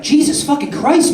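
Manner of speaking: high, drawn-out shock; acted-out alarmed reaction; shocked exclamation. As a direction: exclaim it high and slow, appalled. Roommate reacts to a suicide note.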